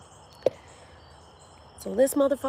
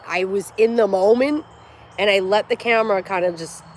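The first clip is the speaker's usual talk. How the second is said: normal talking voice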